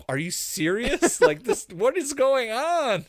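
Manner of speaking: high, slow mock outrage; exasperated disbelief. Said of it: "Michael's like".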